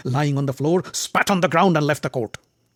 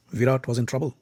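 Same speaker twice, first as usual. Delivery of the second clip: plain reading voice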